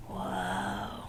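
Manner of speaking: soft breathy low whoa; awed whoa. Acted. Storyteller gasping in awe at the museum. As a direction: marvel at it quietly.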